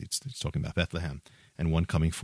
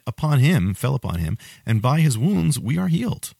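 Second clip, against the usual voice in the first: slow, louder, rising preaching reading of the suffering servant; fervent scripture reading; 3 semitones higher, 4 dB louder than usual. Acted. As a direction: build the passage with mounting fervour, higher and louder. Reads Isaiah passage with rising force.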